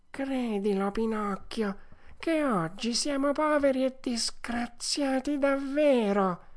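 high, slow pleading whine; whining fox. A narrator's reading. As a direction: plead pitifully to be believed. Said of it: "con voce di piagnisteo". (volpe supplichevole, piagnisteo alto e lento)

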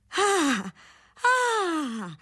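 high, mocking Ah Ah laugh bursting out; a scornful spinster's laugh that won't stop; burst of laughter. A narrator's reading. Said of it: "scoppiata in una risata che non finiva più".

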